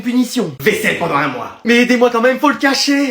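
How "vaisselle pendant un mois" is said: imitating mom. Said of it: "elle sait toujours quand j'ai eu une mauvaise note".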